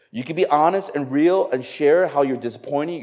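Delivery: normal talking voice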